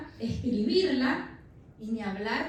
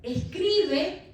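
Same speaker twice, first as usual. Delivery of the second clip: loud, higher command; God's command; 7 semitones higher, 6 dB louder than usual. Acted. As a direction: call it out as a divine order. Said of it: "Dios le dice a Habacuc". (orden fuerte y aguda)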